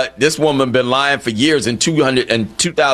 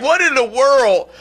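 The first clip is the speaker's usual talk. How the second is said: high-pitched, disbelieving rhetorical questions; incredulous; 11 semitones higher than usual. Acted. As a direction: demand an answer in sheer disbelief. Host confronts absent pretender rhetorically.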